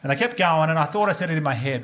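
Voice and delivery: ordinary delivery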